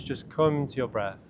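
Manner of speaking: ordinary delivery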